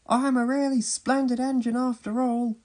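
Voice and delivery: high, self-important engine voice; high-pitched vain engine character; James. A narrator's reading. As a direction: boast in a high, put-on voice. Vain James praises himself.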